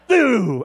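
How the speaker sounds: high, loud imitated stabbing sound; comic swoosh of a pretend stab; loud falling mock-attack noise.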